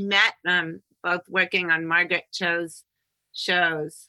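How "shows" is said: "shows" with a long hissed opening sh; drawn-out sibilant. Pretend. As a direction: stretch the first sound of "shows". Drawn-out sibilant running joke.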